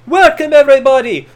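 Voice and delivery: a lightly put-on Irish accent, hamming the promo; Irish lilt parody, drawing out fella; mock Sheamus Irish brogue. Recapping Sheamus's promo to viewers, mock Irish accent. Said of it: "he cuts a pre-match promo saying".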